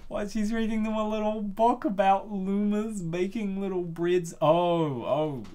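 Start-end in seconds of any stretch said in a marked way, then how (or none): none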